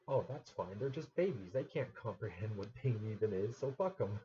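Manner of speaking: mocking dismissive voice. Mocking the people who defend circumcision, loud sneering voice. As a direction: throw their excuse back, scornful and loud.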